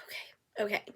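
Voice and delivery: very soft whisper; cowed teen agreeing to the paramedics; meek whisper. Retold or imitated. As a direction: barely breathe it. Meek reply.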